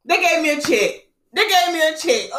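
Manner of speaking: high, thrilled repetition; excited exclamation. Retold or imitated. Vlogger telling viewers about the insurance check, excited exclaiming. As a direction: exult over the windfall. Exulting over the surprise check.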